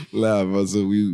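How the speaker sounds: ordinary delivery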